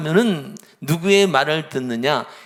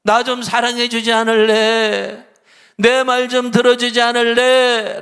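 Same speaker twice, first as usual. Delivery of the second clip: loud, higher, slow earnest plea; pleading; 6 semitones higher, 9 dB louder than usual. Retold or imitated. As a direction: beg them to love and listen. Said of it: "라고 이렇게 말씀하실 때". (크고 천천히 간절하게 호소하며)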